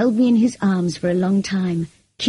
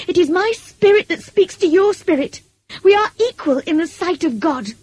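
high-pitched, impassioned outburst; furious; 9 semitones higher, 4 dB louder than usual. A narrator's reading. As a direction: challenge him with rising indignation. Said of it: "I cried furiously".

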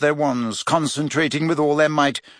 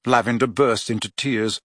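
plain reading voice

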